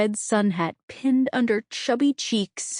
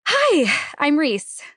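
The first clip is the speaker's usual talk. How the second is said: perky greeting; 7 semitones higher, 4 dB louder, much slower than usual. A narrator's reading. Perky mom greets at door.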